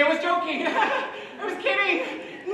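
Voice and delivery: high, loud, laughing panic; nervous laugh. Retold or imitated. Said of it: "Do you say".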